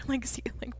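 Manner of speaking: breathy half-laughed whisper; whispered aside. Real, not acted. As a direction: whisper it, barely any voice. Unvoiced aside after laugh.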